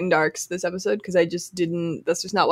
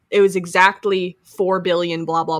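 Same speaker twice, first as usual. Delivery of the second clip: ordinary talk, nothing marked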